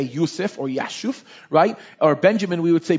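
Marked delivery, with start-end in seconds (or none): 0.0-1.2 Yusuf, Yashuf with a Y sound; Hebrew pronunciation. Shown as a demonstration. Pastor giving Hebrew forms of Joseph, Yusuf and Yashuf. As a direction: say Yusuf then Yashuf, Y not J. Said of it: "instead of Joseph, we would say".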